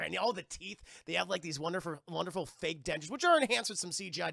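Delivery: ordinary delivery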